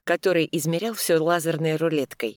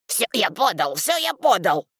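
rising defensive yell; shouting; 5 semitones higher, 3 dB louder than usual. Acted. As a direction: yell it back in protest. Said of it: "орёт вдруг глава поселения".

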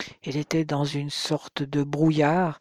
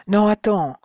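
urgent stop; 5 semitones higher, 6 dB louder than usual. A narrator's reading. Stopping friend from rushing over.

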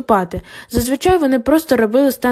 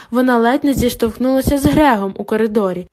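usual speaking voice

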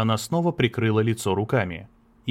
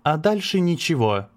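dry reply; 6 semitones higher than usual. Acted. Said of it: "сухо ответила она".

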